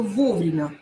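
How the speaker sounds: вовремя stressed on «во»; вовремя with a full opening О. Shown as a demonstration. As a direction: hit the first syllable of вовремя. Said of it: "Ударение на О".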